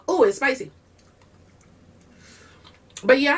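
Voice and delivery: normal talking voice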